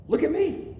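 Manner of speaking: put-on calling a child to look up; parent to child.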